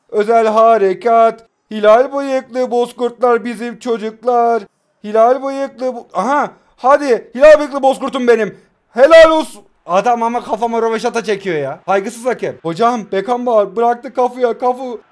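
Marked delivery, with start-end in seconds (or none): 0.1-9.6 joyous goal chant at the screen; goal celebration. Real, not acted. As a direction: chant it like a stadium cheer.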